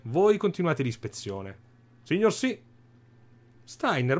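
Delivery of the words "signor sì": eager soldier. Acted. Soldato risponde al comandante.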